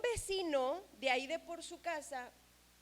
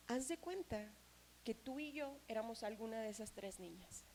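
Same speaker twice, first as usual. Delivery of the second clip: normal talking voice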